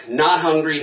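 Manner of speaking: ordinary delivery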